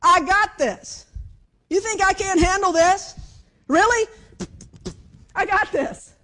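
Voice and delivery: high, loud and commanding; God's bold assurance. Acted. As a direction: boom it out, incredulous at being doubted. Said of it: "God was reminding Elijah of who he was".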